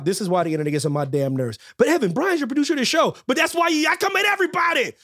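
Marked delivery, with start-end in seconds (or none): 1.8-4.9 worked-up rant. Pretend. Heated comic rant at colleagues.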